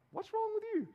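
incredulous uncle, high-pitched; baffled. Pretend. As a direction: squeak out the outrage at being repaid. Uncle Bob reacting to repayment.

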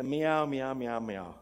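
imitated cat meows, trailing off; mock cat cries, fading; crying cat. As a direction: meow over and over like a cat at the door. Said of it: "it just cries and cries".

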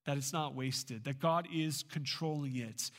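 usual speaking voice

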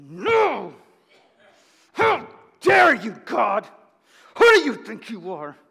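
furious shout at God, loud and high; enraged anger. Acted. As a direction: roar in defiant outrage, dragging out the words. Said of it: "That's anger".

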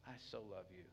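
soft, gentle voice of a loving father; loving God. Acted. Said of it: "he's so lovingly".

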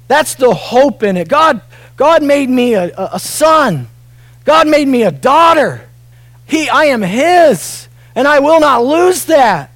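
loud, high, slow preaching; full-force preaching. Real, not acted. Pastor preaching assurance to the congregation, at full force. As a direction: preach it hard from the pulpit.

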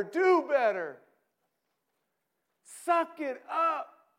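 loud, high pep-talk yelling; shouted encouragement. Acted. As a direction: hype them up like a coach. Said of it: "shouts of encouragement".

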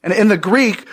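ordinary talk, nothing marked

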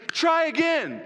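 high, emphatic urging; urging shout. Acted. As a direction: call it out to spur them on. Urging fallen dog to climb.

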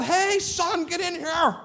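calling out high and loud; retold yell. Pastor calling his son into the room, high and loud. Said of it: "So I yelled".

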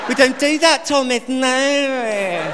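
high, mock-prim English nanny voice, calm and slow; a gentle English scolding, lightly hammed; imitating English nanny. Comic playing the Super Nanny scolding little Thomas, in an English accent. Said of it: "She's like".

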